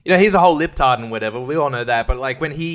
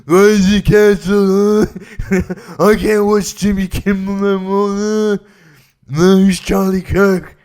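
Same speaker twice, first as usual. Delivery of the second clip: slow, higher, louder dim-witted drawl; mock a confused YouTuber as a fool; hammed-up dopey caricature; 6 semitones higher, 7 dB louder than usual. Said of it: "making fun of ... in that kind of voice".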